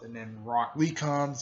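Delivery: plain reading voice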